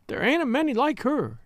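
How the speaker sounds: high-pitched, lightly acted tearfulness; understated weepy young maid; sobbing. A narrator's reading. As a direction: lament her with a touch of tears. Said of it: "sobbed Alice".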